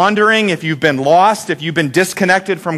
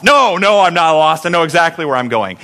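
mock indignant denial; defensive husband. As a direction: deny it hotly, cutting her off. Husband's defensive retort to wife.

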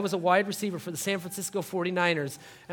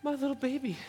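high, soft doting coo; mock proud parent; 7 semitones higher, 5 dB quieter than usual. Dad moved watching six-year-old daughter, tender high cooing. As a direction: gush softly over your child. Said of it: "you're just like".

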